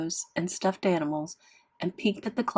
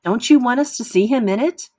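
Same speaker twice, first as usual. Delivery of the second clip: louder, higher pressing question; arguing; 4 semitones higher, 7 dB louder than usual. A narrator's reading. Mother arguing with her daughter, exasperated. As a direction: raise the voice in exasperation.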